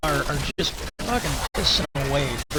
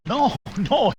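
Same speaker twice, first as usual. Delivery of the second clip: retold chuckling denial, pitched up; amused denial; 8 semitones higher, 3 dB louder than usual. Old boss laughs off the question as retold, amused. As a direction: imitate someone laughing off a question, high. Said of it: "the dude started laughing".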